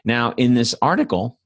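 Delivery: ordinary talk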